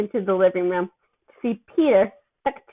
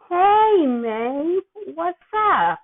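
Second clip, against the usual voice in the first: bright fake-casual greeting, higher on hey; put-on cheer; 7 semitones higher, much slower than usual. A narrator's reading. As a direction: hide the panic behind a cheerful hello. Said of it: "He sounded happy enough".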